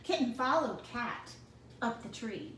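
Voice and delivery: usual speaking voice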